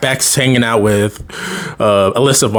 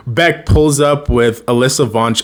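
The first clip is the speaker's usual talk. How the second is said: ordinary talk, nothing marked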